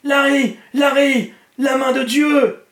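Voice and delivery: very high, loud ecstatic yell; screaming. A narrator's reading. Ralph shows Larry the hand in the sky, screaming. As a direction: scream it out, stretching the last phrase. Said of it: "Ralph se mit à hurler".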